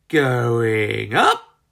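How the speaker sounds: low, lightly voiced hen shout; excited cry. A narrator's reading. Ginger leaping onto the buckets, a lightly voiced cry. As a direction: sing out as she jumps. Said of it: "cried Ginger".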